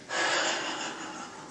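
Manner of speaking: long unvoiced sigh of release; demo sigh. Shown as a demonstration. Teacher to audience, a long releasing sigh. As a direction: let it all go with a sigh. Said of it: "let go".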